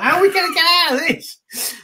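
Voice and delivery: very high panicked squeal, hammed up; mock panic. Stranded double act after kid's answer, mock panic.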